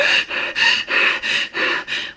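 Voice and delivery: imitated hyperventilating gasps; rapid heaving breaths, acting out panic; heavy breathing. Shopper in a panic attack, demonstrating heavy breathing. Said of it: "I'm breathing real heavy. I'm like".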